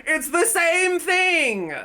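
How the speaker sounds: high loud slow laughing exclaim. Real, not acted. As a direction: laugh it out in disbelief. Laughing exasperation.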